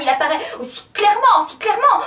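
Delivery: loud, high-pitched insistence; loud, swooping emphasis on the repeat; agitated declamation. Acted. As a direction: push it up into an agitated cry. Monologue gets agitated.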